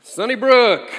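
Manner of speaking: loud, high call to the room; hearty greeting. Real, not acted. Preacher greeting his congregation, loud and bright. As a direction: greet the whole church with a big shout. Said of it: "greeting the congregation".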